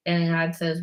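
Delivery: ordinary talk, nothing marked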